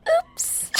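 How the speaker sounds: tiny high-pitched oops; mock innocent oops. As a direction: feign an accident in a squeaky little voice. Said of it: "Lila goes".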